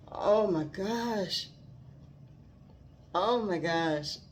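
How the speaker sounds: ordinary talk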